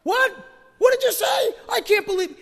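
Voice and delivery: hammed-up anger in a heated conversation; mock heated outburst. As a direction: play the offended arguer, flaring up. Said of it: "we can get a little heated".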